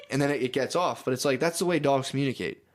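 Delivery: ordinary talk, nothing marked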